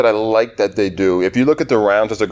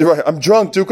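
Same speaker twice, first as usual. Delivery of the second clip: usual speaking voice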